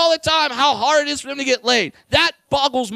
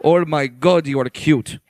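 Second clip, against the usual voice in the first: very low cooing; low mock-adoring voice; put-on doting voice; 10 semitones lower than usual. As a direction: coo deep down in your range. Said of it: "I'm doing that voice".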